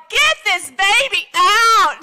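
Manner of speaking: high, loud, drawn-out demand; labor-room yell. Acted. Preacher rallies crowd as a woman in labor, yelling. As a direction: holler it like a mother mid-labor.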